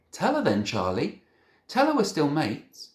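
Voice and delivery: high, loud desperate shout. A narrator's reading. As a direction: shout it at him. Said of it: "I shouted".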